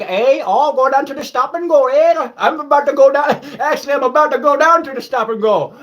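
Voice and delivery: high, loud hammed-up Upper Midwest accent with eh; mock northern accent. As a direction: play up the northern Wisconsin accent. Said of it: "You gotta love this accent up here".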